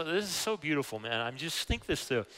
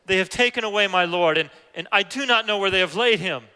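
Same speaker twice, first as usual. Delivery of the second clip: distressed plea; 6 semitones higher, 9 dB louder than usual. Acted. Weeping Mary pleads about body.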